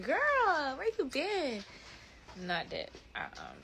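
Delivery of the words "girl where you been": mock excited greeting. Retelling greeting a classmate at the hospital, mock excited. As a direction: greet her like an old friend. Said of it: "And I was like".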